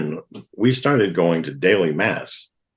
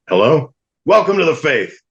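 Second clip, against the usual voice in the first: booming, much higher, much louder; priest going deaf; 10 semitones higher, 6 dB louder than usual. Retold or imitated. Priest hard of hearing greets the new convert, booming. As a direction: shout down the phone like a deaf old priest. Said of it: "he talks like everybody's starting to go deaf".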